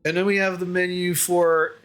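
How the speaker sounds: apologetic host, stumbling over words; hesitant tavern host. Acted. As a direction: play an innkeeper trying not to offend.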